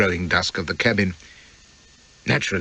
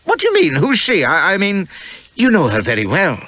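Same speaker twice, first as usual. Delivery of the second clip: put-on higher, breathless young wife demanding answers; a jealous young wife pressing her husband; Elspeth's voice; 9 semitones higher, 3 dB louder than usual. Young wife confronting husband over another woman, high and urgent.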